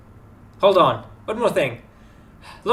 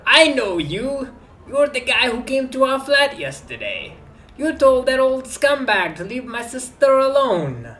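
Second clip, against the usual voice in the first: put-on high, slow street-kid voice; young man character voice; 9 semitones higher, 3 dB louder, slower than usual. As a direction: play the streetwise kid, pitched up.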